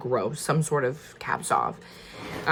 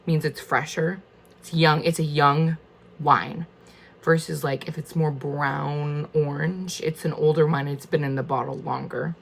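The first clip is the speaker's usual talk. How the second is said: normal talking voice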